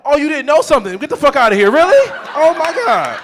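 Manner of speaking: high, loud sarcastic outrage; over-the-top mocking shout at girlfriend; mock yell. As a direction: yell it in disbelief. Mocking her ignorance.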